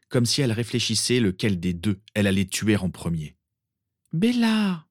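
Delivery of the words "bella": much higher call of alarm; a hint of a sister crying out in alarm. A narrator's reading. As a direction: cry out to stop her. Narcissa cries out to her sister.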